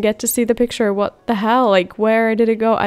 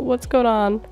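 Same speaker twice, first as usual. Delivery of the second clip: normal talking voice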